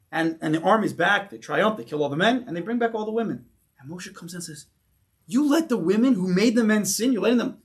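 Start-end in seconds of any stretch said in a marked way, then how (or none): 5.3-7.6 raised, higher indignant rebuke; loud retold reproach; very angry. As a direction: act out a leader angrily scolding the troops. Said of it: "He gets very angry".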